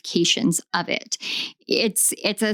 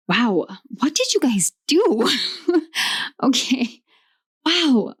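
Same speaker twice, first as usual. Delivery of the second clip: high-pitched wow breaking into a laugh; amazed; 4 semitones higher than usual. Real, not acted. Reader marvelling at what you guys did, amazed and laughing. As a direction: react with amused, real astonishment.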